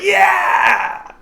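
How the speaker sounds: high cheering shout; triumphant yell. Acted. Gambler cheering as he throws chips, loud whoop. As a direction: whoop like you just won. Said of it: "He's like".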